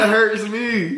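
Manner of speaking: high, playful wounded tone; joking that her denial stings; mock hurt. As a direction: pretend to be hurt, teasingly. Boyfriend reacts to the breakup plea.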